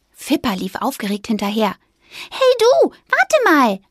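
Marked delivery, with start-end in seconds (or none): 2.3-3.8 high, loud squeaky call; loud squeak. A narrator's reading. Mouse calling a passing squirrel, loud squeak. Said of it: "fiepste sie laut".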